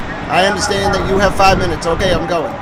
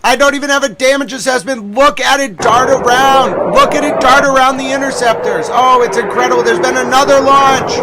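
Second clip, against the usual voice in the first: loud, high, breathless hype over the footage; excited shouting; 6 semitones higher, 6 dB louder than usual. Real, not acted. Streamer shouting at missile footage on stream, excited yelling. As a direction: yell it like you can't believe what you're seeing.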